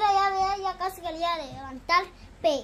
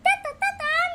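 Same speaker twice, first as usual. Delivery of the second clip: little brother; 13 semitones higher, 4 dB louder than usual. Retold or imitated. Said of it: "Le decía mi hermanito".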